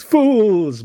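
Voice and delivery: gloating villain yell; villain shout. Retold or imitated. Reading a villainous comment aloud.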